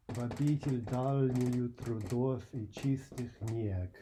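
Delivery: slow, measured Russian verse reading; poem recitation. Acted. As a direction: recite it slowly, marking the meter. Reads Russian poem aloud.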